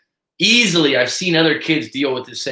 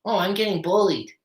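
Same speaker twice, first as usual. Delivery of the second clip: high whiny complaint; mock whining kid; 8 semitones higher than usual. Kid who failed the test, lightly mocked whining. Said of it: "What happens?".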